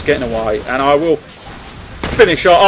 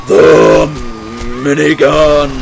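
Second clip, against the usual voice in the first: loud triumphant yell of "minigun"; excited shout; 7 dB louder, much slower than usual. Real, not acted. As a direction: bellow it like a war cry. Shouting for the big gun.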